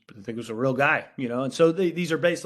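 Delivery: usual speaking voice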